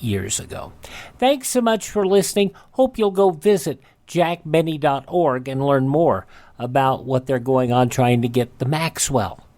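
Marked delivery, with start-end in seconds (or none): none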